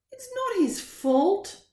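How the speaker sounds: put-on high, slow pleading boy; child voice. Child Ben defends his dog.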